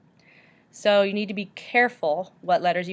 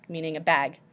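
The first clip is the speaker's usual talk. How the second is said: ordinary talk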